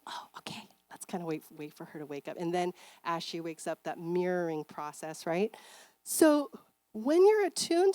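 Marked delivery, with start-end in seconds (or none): 0.1-2.4 soft, half-whispered calm; gentle hush. Shown as a demonstration. Parent quietly letting the sleeping baby wake up. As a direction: ease off so the baby can wake. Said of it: "more attuned would be".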